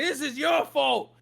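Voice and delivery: high, loud furious shouting; angry guard. Acted. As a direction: accuse them with rage. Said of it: "He's like".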